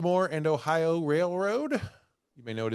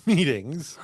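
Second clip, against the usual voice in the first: normal talking voice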